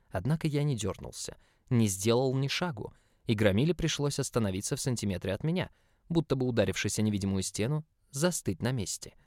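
plain reading voice

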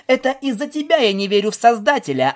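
quick, high-pitched comeback; crisp retort. Acted. Pegasus trading insults with the stallion, crisp retort. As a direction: rap out the insult in reply. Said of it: "отчеканила в ответ Пегаска".

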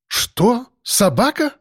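high startled cry, pitch jumping up; a doctor's shocked high exclamation; exclaiming in surprise. Acted. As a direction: caught off guard, voice leaping high. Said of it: "воскликнул врач".

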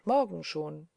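plain reading voice

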